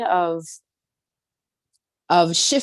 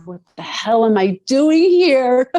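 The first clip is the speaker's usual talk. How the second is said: higher, louder, slow desperate cry; louder, slowed retelling of her yell at the volcano; retold yell; 7 semitones higher, 7 dB louder, slower than usual. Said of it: "I yelled and I screamed".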